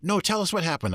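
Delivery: high, eager demand; pleading kids. Retold or imitated. Children insisting the teacher tell the story, eager. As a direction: whine like impatient children.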